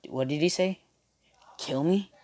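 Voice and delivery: hushed, puzzled mock reaction; confused. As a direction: act baffled, dropping almost to a whisper. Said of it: "he's confused in all this shit".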